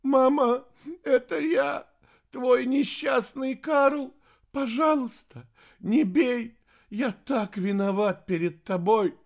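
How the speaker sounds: high, loud, tearful begging; sobbing plea. A narrator's reading. Wretched son begging mother for mercy, sobbing. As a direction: beg through sobs, pitched high. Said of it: "сквозь рыдание произнёс наследник".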